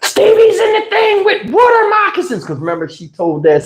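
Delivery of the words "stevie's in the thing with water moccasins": little brother bawling to grandma; panicked crying kid. Retold or imitated. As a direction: sob out the news to grandma.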